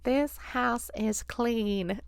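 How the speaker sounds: very slow, soft pronouncement; psychic lady. Retold or imitated. Psychic lady pronouncing the house safe, slow and soft. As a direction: draw out each word like a psychic. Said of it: "the little psychic lady goes".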